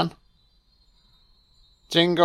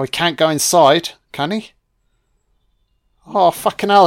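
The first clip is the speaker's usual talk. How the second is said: usual speaking voice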